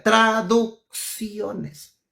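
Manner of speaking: Traducciones drawn out very slowly, each syllable spelled out; stress on Traducciones. Shown as a demonstration. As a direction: stretch out Traducciones to make the point. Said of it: "me refiero a las traducciones". (Traducciones, muy lento y enfatizado)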